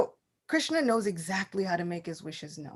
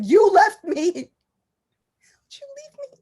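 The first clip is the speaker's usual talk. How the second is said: put-on hurt child; 11 semitones higher, 9 dB louder, much slower than usual.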